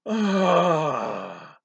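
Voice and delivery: relieved long sigh. A narrator's reading. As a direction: let the anger drain out. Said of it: "he sighed".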